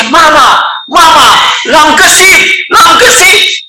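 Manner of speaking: high, loud calls building up; rising shouts of discovery; excited shout. Acted. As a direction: call out to mother, thrilled. Child runs shouting to mother.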